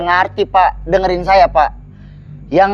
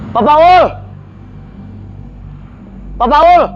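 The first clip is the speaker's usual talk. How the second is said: calling out; 8 semitones higher, slower than usual. Acted. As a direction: shout his name to find him. Calling out for missing man.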